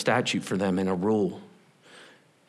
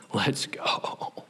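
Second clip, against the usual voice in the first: soft whispered cheer; 3 semitones lower, 6 dB quieter than usual. Real, not acted. Preacher urging the crowd, whispered. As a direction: hush it out. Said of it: "whispered aside".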